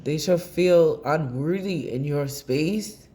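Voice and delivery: usual speaking voice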